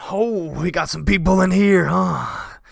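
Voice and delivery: a bandit chief breaking into a house; bandit king. Acted. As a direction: bellow to his men.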